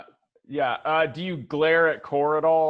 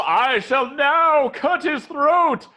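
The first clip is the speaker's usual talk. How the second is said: high, loud, drawn-out theatrical declamation; hammed-up performance; 6 semitones higher, 4 dB louder, slower than usual. Game master mimics Spot's botched performance check, hammed-up declaiming. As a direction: overact a grand vow to slaughter. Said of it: "he's all".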